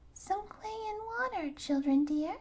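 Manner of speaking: put-on little birds twittering advice to the children; chirping little birds. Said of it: "birds chirped again".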